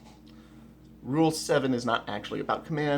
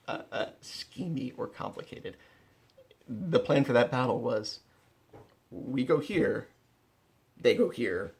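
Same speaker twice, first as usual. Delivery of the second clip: ordinary delivery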